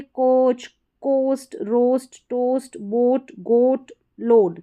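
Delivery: कोच, कोस्ट, रोस्ट with a long, pure "oh". Shown as a demonstration. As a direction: say टोस्ट, बोट, गोट as separate words with a clear "oh" vowel. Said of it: "ओ करके हो रहा है".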